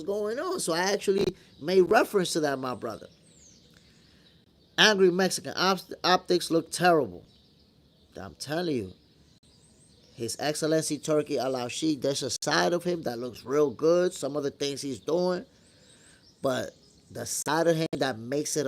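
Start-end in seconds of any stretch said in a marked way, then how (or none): none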